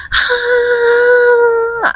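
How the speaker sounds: high strained push cry; a retold high labour shout. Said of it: "I just went".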